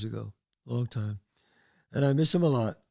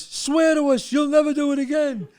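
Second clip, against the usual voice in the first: high, forceful yell; demanding father; 10 semitones higher, 3 dB louder than usual. Retold or imitated. Father demands Timmy swear, high insistent yelling. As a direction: press your son hard for a promise.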